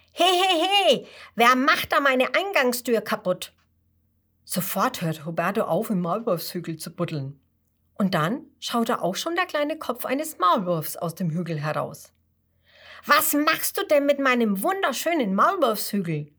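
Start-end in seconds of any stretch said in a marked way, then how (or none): 0.1-3.5 indignant yelling, higher and louder; angry mole. Acted. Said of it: "da hört er eine wütende Stimme". 13.1-16.3 cross, higher questioning in a mole voice; annoyed mole. Acted. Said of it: "fragt der Maulwurf verärgert".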